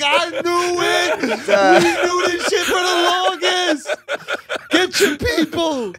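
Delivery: high, slow comic hollering; hammed-up high yell; mock outraged shout. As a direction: holler it like a told-you-so, half laughing. Acting out reaction to the aliens.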